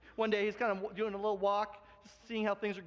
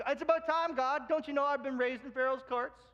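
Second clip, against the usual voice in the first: eager Moses; 6 semitones higher than usual. Retold or imitated. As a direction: play young Moses bragging to God. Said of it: "he's like".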